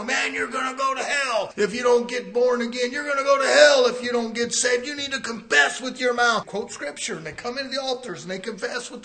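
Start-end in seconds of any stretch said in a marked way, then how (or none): 0.0-6.5 high, loud fire-and-brimstone preacher; scary evangelist. Retold or imitated. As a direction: scare them straight into the altar. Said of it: "he comes in and scares them".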